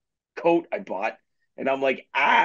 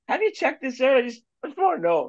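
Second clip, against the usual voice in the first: much higher, sweetly innocent questioning; imitating Lindsay; 12 semitones higher than usual. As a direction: ask innocently, pitched way up. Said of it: "Lindsay was sitting there and just like".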